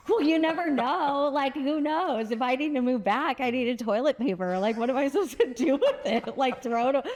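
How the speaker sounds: high-pitched, protesting self-defense; defensive comeback. Retold or imitated. Acting out her defensive comeback.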